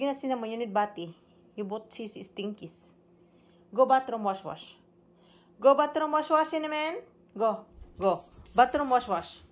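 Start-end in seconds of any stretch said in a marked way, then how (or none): 3.7-9.4 loud high repeated orders; loud dog command. Real, not acted. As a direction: push the dog toward the bath, louder.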